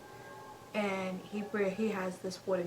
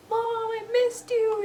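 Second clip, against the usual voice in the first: high, loud, slow imitated child; little boy's greeting; 13 semitones higher, 8 dB louder, slower than usual. Doll son greets his mom, high and joyful. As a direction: draw it out high like a son reunited.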